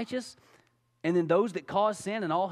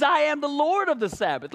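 bold declaration; 4 semitones higher, 4 dB louder than usual. Acted. Jesus answering the religious leaders, bold proclamation. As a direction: proclaim it, ringing and sure.

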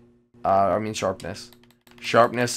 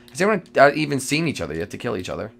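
ordinary talk

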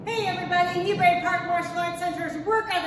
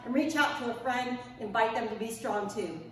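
quiet, lower farewell; soft sign-off; 7 semitones lower, 6 dB quieter than usual. Real, not acted. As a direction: wind down gently. Gentle sign-off.

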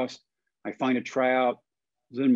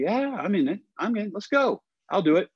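eager and excited; 8 semitones higher than usual. Retold or imitated. Excitedly accepting the kids' bet.

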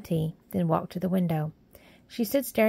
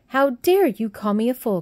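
louder, indignant outburst; in a rage; 5 semitones higher, 7 dB louder than usual. A narrator's reading. Mary raging at the maid, loud indignant cry. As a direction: fling the words back at her. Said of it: "cried Mary in a rage".

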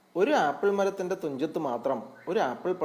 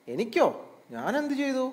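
pitched way up in astonishment; surprised bear; 10 semitones higher than usual. A narrator's reading. Bear offered a share of the apple, surprised. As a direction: squeak it out, truly taken aback. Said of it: "കരടി അത്ഭുതപ്പെട്ടു".

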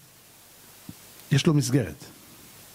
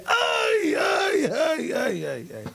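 mock wailing; slower than usual. Father showing the wicked's later suffering, mock wailing. Wicked people's cries afterwards, acted.

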